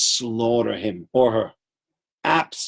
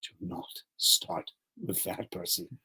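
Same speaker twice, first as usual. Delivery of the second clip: awed murmur; 7 semitones lower, 14 dB quieter than usual. Retold or imitated. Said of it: "everybody else in the class will look and go".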